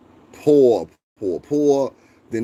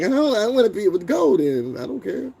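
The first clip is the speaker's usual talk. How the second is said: high, sneering parody of a whiny person; mimicking someone who doesn't care, much higher; mocking whiny voice; 11 semitones higher than usual. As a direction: squeak it out like a spoiled brat mocking them. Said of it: "I hate when somebody be like".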